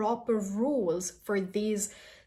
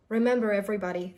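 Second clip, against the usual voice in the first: ordinary talk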